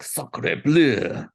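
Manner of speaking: hammed-up French outburst; mock French exclamation. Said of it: "with the accent that you were doing".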